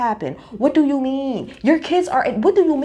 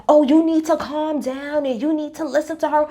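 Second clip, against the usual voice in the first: mock soothing; 5 semitones higher than usual. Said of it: "telling Meredith".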